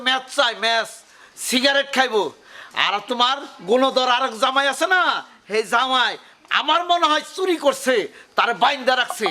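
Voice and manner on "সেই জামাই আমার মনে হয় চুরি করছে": ordinary talk, nothing marked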